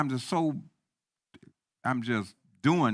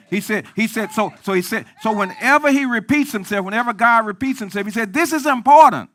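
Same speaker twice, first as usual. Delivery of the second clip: rising higher and louder into a preacher's shout; building to a shout; 10 semitones higher, 11 dB louder than usual. Acted. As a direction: build it up until it bursts out. Preacher building to full force.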